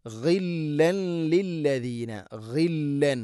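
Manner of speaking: ഗില്ലൻ with a gargled ഗ from the upper throat; ghain sounded. Shown as a demonstration. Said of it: "ഗൈന്, തൊണ്ടയുടെ മുകൾ ഭാഗം". (ഗില്ലൻ ഗൈൻ ഉച്ചാരണം)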